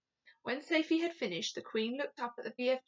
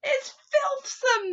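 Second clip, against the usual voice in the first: high, loud and drawn-out; disgusted cry; 14 semitones higher, 10 dB louder, slower than usual. A narrator's reading. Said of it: "the B F G cried".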